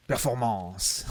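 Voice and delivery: "Performance" as French "pair-for-MAHNS"; French pronunciation. Shown as a demonstration. Streamer testing French words, "Performance" said as in French. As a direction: say "Performance" with French r and nasal ending. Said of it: "actually using, like, the French words".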